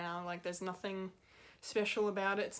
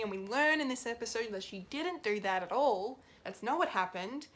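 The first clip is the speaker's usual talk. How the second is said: indignant rant; 3 semitones higher, 4 dB louder than usual. Real, not acted. Fan tearing into Hayward for his hypocrisy, indignant. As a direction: let the exasperation build and spill over.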